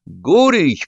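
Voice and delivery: high summoning call; calling someone. A narrator's reading. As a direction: call the name out to someone in a tent. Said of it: "позвал Тимур Артурович".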